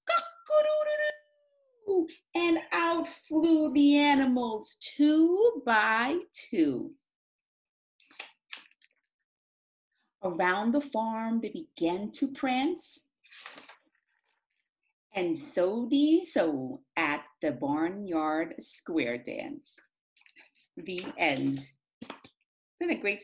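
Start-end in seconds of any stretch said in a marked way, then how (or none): 0.1-2.1 high, slow cock-a-doodle-doo; rooster crow. A narrator's reading. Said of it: "a rooster crowed". 2.3-21.6 put-on lively, sing-song read-aloud for children; story reading. A narrator's reading. Reads the picture book aloud.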